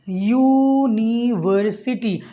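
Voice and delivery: very slow, stretched out syllable by syllable; slow motion. Shown as a demonstration. Teacher says university in slow motion for students. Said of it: "इसको थोड़ा सा मैं slow mo में बोलता हूँ".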